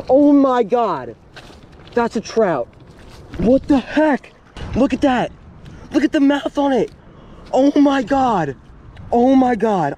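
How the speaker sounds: high, loud, stunned; overwhelmed with joy at the catch; real excitement. Real, not acted. Angler holding his record trout, high stunned exclaiming.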